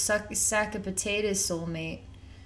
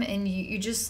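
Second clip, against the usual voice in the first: normal talking voice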